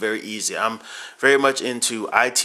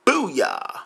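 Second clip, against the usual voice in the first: high, punchy booyah; excited cheer; 10 semitones higher than usual. Acted. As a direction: throw out a happy cheer. Sign-off cheer after shoutout.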